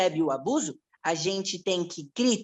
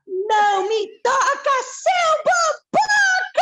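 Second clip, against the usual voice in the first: very high, drawn-out playful scream; gritando junto; 4 dB louder, slower than usual. Acted. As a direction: yell it at full pitch, stretching the last word. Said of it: "Quer gritar com ela?". (grito agudo e esticado)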